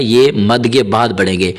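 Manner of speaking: usual speaking voice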